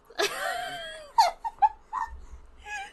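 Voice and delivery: squeaky helpless laugh; high squealing laugh. Real, not acted. Burst of laughter.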